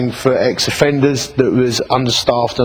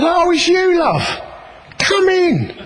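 much higher, tender imitation of the woman; slower than usual. Woman at number five welcoming him in, high and tender. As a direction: hold it high and gentle like a changed woman. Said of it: "and she said".

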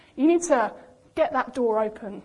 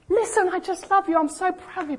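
high, insistent, louder declaration of love; fatherly shout; 7 semitones higher, 3 dB louder than usual. Acted. The Father answering a doubting child, loud insistent pride. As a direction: insist on it over the objection. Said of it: "what the Father shouts the loudest".

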